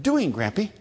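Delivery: mimicking a toddler's shortened question; toddler talk. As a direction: squeeze it into two words like a toddler. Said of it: "it's just".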